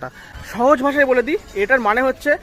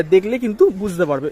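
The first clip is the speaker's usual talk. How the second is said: normal talking voice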